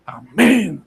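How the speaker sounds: mock self-congratulation, drawn-out amen; secret inner glee. Preacher acting his inner reaction to praise, secret glee. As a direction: privately relish the praise, hammed up. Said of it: "y uno por dentro".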